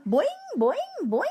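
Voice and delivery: high twanging boing, boing; spring the boings up high like a bouncing frog; twangy frog boing. A narrator's reading. Og the frog leaping on his rock, high twangy boings. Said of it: "he twanged".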